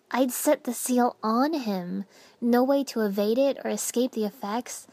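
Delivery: usual speaking voice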